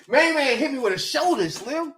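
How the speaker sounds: ordinary talk, nothing marked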